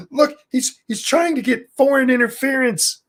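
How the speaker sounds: high, squeaky mock-alarmed reporter voice; mocking the press. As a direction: pitch it way up like a panicking pundit. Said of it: "the press is like".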